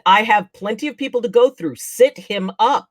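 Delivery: insist, drawing out the final command; impatient doctor repeating an order; firm insistence. Retold or imitated. Insists after the kids hesitate.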